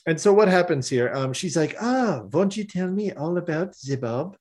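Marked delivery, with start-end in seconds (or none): 1.8-4.4 higher, coaxing French woman; put-on French accent, lilting up to a question; terrible French accent. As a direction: coax sweetly in a fake French accent. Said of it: "my terrible French accent".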